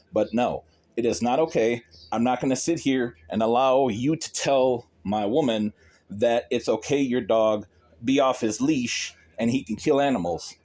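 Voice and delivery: ordinary talk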